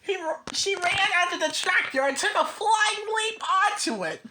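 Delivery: plain reading voice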